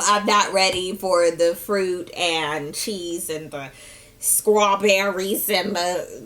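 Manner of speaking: slow, whining tearful; mock weepy. Lil B overwhelmed by the surprise, slow and weepy. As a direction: whimper it slowly.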